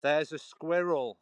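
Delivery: slow careful Queen's English; There's a squirrel in slow Queen's English; posh demo. Shown as a demonstration. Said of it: "trying to do it Queen's English".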